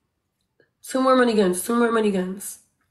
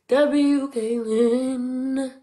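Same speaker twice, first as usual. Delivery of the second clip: very slow, stretched syllables; drawn-out cheer; 3 semitones higher, 4 dB louder, much slower than usual. Real, not acted. Streamer leading the chat's spam for Caitlin, drawn-out cheer. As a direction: hold out each sound of the cheer.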